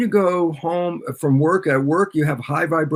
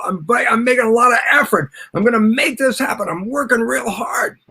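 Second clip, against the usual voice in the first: higher-pitched, effortful insistence; mock striving; 7 semitones higher than usual. Lecturer acts out someone working hard, mock striving. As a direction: push it out as if straining hard.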